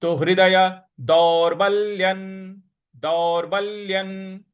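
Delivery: दौर्बल्यन slow, weight on the half ल; stress on ल. Shown as a demonstration. Teacher models दौर्बल्यन slowly, stressing र and ल. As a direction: lean into र and ल of दौर्बल्यन. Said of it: "उस पर भी हमको थोड़ा सा बल लगाना है".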